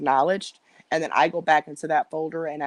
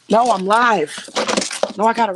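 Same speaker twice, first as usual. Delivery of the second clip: louder, firm no to someone off camera; loud protest; 4 semitones higher, 7 dB louder than usual. Real, not acted. Streamer telling family she is live, louder protest. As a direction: cut them off firmly.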